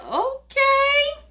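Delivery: very high, drawn-out okay; put-out okay. Retold or imitated. Brushed off by the waiter, a high put-out reply. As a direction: stretch it way up in disbelief. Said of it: "So I was like".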